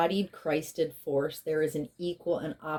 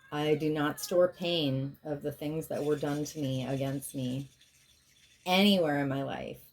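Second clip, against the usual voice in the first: ordinary delivery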